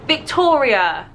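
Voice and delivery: ordinary delivery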